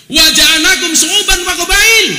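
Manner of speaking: loud, slow chanted Arabic verse; Quranic recitation. Acted. Preacher reciting the Quran verse to the crowd. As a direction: recite it like a preacher quoting scripture.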